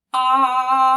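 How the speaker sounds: "Ah" sung out loud and stretched on one note; long held ah. Shown as a demonstration. Teacher stretching the continuous sound for the class. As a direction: hold "Ah" out until your breath runs out. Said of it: "we can stretch it out for as long as we have air".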